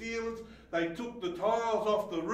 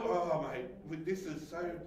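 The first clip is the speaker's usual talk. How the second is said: usual speaking voice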